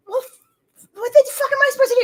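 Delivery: mock exasperation, quick and high; exasperated outburst. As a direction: throw up your hands, speeding up. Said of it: "And I'm like".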